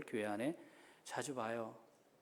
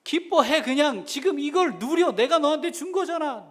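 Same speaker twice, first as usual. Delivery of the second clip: high, loud, booming voice of God pleading with a laugh; a loud, high-pitched God who won't take no for an answer; God urging joy; 12 semitones higher, 12 dB louder than usual. Acted. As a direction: play God pushing his child to rejoice.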